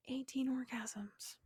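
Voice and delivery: slow, hushed whisper; whispered aside. Real, not acted. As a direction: whisper it slowly like a naughty secret. Confiding a count in a whisper.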